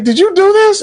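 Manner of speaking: high-pitched imitation of an accusing question; mock confrontation. Queen confronts Damon about the killing, high mock accusation.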